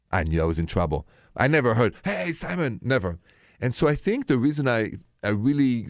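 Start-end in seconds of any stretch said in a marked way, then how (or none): 2.0-2.8 high-pitched, mild mock call; half-hearted yell, pitched up. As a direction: imitate a parent yelling, without the volume. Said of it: "The less we yell".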